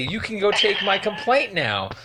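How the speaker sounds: usual speaking voice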